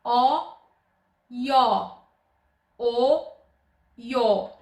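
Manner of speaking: O said open, then io with i glide, loud and careful; o versus io contrast. Shown as a demonstration. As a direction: make the open O and the io clearly different. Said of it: "Ascultați cu atenție diferența".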